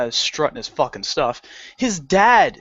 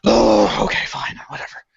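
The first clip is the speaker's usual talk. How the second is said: resigned deep sigh; 5 semitones higher than usual. Retold or imitated. Employee giving in to the discount check, resigned sigh. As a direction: sigh heavily, then give in under your breath. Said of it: "deep sigh".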